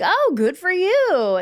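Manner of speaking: high, slow, sing-song politeness; slow, high, faintly fake cheer; mock bright reply. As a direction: brush it off with an overly sweet lilt.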